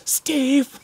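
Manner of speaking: high, loud cry of a name; wrangler's reproachful shout. Retold or imitated. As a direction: imitate an appalled wrangler calling out. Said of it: "the wrangler's looking at me".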